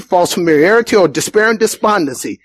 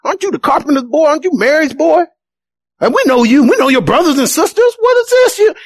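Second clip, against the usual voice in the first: incredulous townsfolk; 7 semitones higher than usual. Retold or imitated. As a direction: scoff in disbelief at the carpenter's boy. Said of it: "they were like".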